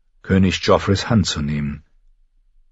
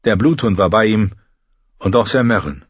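ordinary delivery, nothing marked

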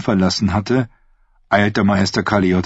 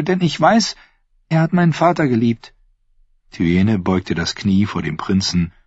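put-on young woman's voice set higher than the narration, sweetly scheming; Thyene's voice; 10 semitones higher than usual. Thyene to her uncle the prince, sweetly scheming. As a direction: speak as the sly, innocent-sounding niece.

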